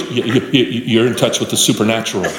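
low, awestruck mock stammer; shaken, stammering. Spooked golfer to his praying partner, mock shaken. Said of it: "And he's shaking".

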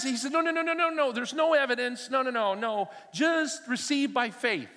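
high, loud flustered string of no's; panicking pastor waving it all off; nervous no no no. Retold or imitated. Pastor nervously refusing tongues.

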